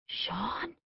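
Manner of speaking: a stunned, hushed whisper with a rising question. A narrator's reading. Kate recognizing her old love, whispered in shock. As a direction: breathe his name in disbelief. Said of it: "she whispered".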